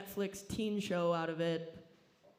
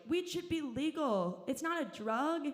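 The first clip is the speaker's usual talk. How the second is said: mock stoner; 8 semitones higher, 3 dB louder than usual. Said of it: "The same people who are like".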